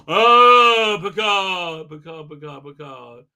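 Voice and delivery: mock cry to Picard. Reviewer imitating someone calling Picard, hammed-up cry fading away. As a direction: call out, then trail away.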